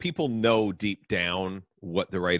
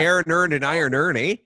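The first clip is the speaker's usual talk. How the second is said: higher, louder Canadian "Aaron earned and iron earn, eh"; mock Canadian accent; 9 semitones higher, 6 dB louder than usual. Cohost teases Canadian actor, mock Canadian accent.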